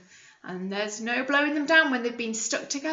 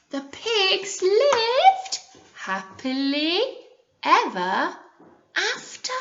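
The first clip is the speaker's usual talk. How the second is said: slow, higher storybook close; fairy-tale ending; 8 semitones higher, slower than usual. A narrator's reading. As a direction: savour the happy ending.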